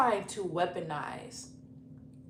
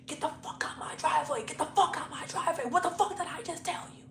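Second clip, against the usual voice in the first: higher, fast imitation of an enraged man; mock furious rant, pitched up without full volume; retold rage; 7 semitones higher, faster than usual. Boyfriend chasing her off his driveway, imitated rage. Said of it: "he starts to get enraged".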